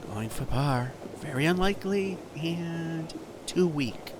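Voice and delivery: hushed TV golf announcer voice; golf commentator. Acted. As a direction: call each shot softly like televised golf. Mock golf play-by-play.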